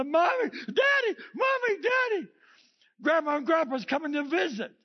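put-on high, loud child's cry to parents; excited kids shouting. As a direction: shout it like a thrilled little kid.

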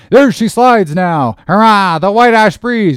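high, loud, jubilant shouting; exhilarating cry. A narrator's reading. Three mates backing their oarsmen, exhilarating cry. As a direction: cheer the rowers on at full voice. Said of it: "with the exhilarating cry of".

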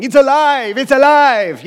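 high, loud triumphant yell; crazed, loud cry of triumph; mad doctor yelling. Retold or imitated. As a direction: shout it like a mad scientist at the switch. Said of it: "going around yelling".

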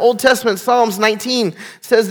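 usual speaking voice

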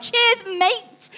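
calling out from the car to the staring man, high and loud; called out. Retold or imitated. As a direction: shout it to the man at the window.

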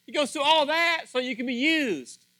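loud, high preaching burst; forceful preaching. Acted. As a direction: drive it home from the pulpit.

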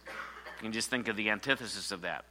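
ordinary talk, nothing marked

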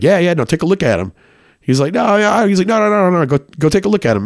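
a high, fast, insistent "go look" voice; pushy dealer. Retold or imitated. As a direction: push him toward the closet. Dealer urging him to the closet.

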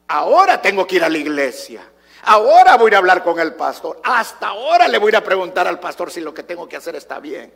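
mock the last-minute churchgoer, hitting each opening hard; parodia exasperada. Parodia a creyentes que acuden tarde. (parodia exasperada, con arranques fuertes)

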